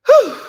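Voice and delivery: loud, high exhaled whew; winded whew. Real, not acted. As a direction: puff it out sharply, out of breath. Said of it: "I am out of breath".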